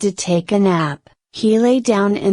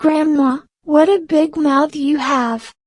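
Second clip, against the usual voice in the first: put-on higher, a touch of fear in the girl's voice; lightly frightened little girl; 7 semitones higher than usual. Girl scared of the wolf, higher and a little afraid. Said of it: "very frightened".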